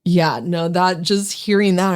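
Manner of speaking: ordinary talk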